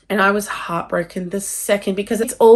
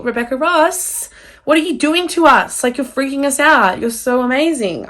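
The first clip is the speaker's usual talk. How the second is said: gushing at the author; 6 semitones higher, 4 dB louder than usual. Retold or imitated. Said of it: "I was like".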